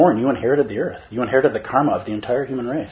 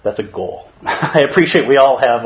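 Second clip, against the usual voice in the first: ordinary talk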